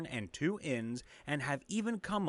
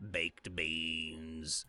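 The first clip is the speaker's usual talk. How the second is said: deep voice; 10 semitones lower, 4 dB quieter than usual. Acted. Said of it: "Why the fuck did he say baked beans like that".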